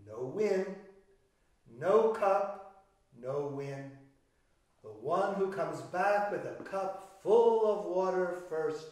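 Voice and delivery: ordinary delivery, nothing marked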